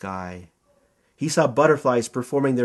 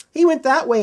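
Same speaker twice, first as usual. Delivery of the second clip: higher, a lightly voiced flower man; 10 semitones higher, 4 dB louder than usual. A narrator's reading. Flower man answering the zookeeper, lightly voiced and higher. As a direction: chirp it out, pointing the way.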